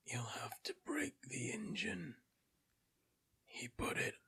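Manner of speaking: raspy whisper of a dying dwarf; guttural dwarf voice. Acted. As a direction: rasp it out like a withered corpse. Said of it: "guttural voice speaking in Dwarven".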